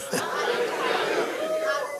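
trailing, soft high laugh. Real, not acted. Preacher to congregation after hallelujah, soft laugh. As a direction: let out a long quiet laugh.

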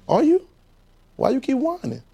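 a mocking high query; high teasing. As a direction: poke at them with a high voice. Teasing challenge to congregation.

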